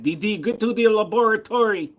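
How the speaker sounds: high-pitched cartoon scientist voice; cartoon boy scientist. Cartoon scientist ordering someone out, high put-on voice. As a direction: call it out high, in character.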